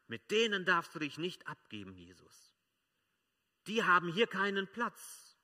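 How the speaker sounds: preacher voicing the Pharisees scolding Jesus, loud and indignant. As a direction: rebuke him as the pious who feel wronged. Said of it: "Das waren die Pharisäer".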